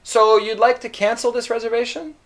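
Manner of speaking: mock-surprised clerk guessing; thinking aloud as Pierre. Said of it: "he's already thinking".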